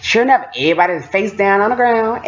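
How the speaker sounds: ordinary delivery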